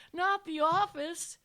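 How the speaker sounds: high, loud, slow plea; hammed-up student begging; pleading. A narrator's reading. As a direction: beg dramatically, stretching the words. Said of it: "he pleaded".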